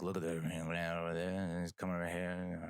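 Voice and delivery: slurred mock Cajun driver; garbled Cajun-ish impression; mumbled Cajun drawl. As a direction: mumble it in Cajun, barely intelligible. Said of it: "Again, I'm doing Cajun".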